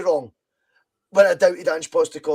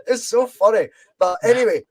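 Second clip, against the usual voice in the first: usual speaking voice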